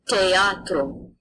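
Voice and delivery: "teatro" slow, plain hard T with no TH; Latin pronunciation. Shown as a demonstration. Teacher showing students that TH is just T, slowly. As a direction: say "teatro" slowly, starting on a crisp T. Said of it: "T H makes a T sound".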